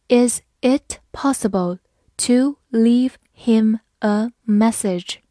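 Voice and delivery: slow question, each word set apart; slow reading. Shown as a demonstration. Teacher modelling a question for learners, slow word-by-word reading. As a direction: read it word by word, slowly. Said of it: "好，慢慢来读".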